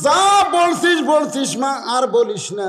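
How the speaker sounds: loud, high anguished plea; preacher acting out a crying man's plea; tearful outcry. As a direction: cry out, begging her to stop. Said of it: "সঙ্গে সঙ্গে আলী কান্দে বলে".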